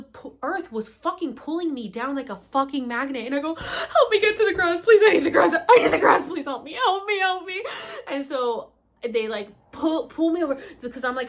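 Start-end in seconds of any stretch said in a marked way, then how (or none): 3.5-7.6 high, loud half-laughing plea, replayed; a hammed-up retelling of a desperate cry for help; desperate begging. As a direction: beg for the grass, loud and high, reliving it. Retold begging for the grass.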